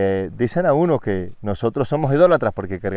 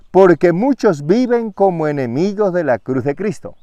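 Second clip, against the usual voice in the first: raised, louder proclaiming of the verse; proclaimed scripture; 7 semitones higher, 4 dB louder than usual. Acted. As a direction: preach it out with force, stressing muchos. First reading of the verse.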